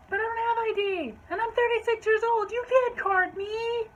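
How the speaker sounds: high-pitched, loud outraged customer; over-the-top indignant wail; going off. Retold or imitated. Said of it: "just started going off".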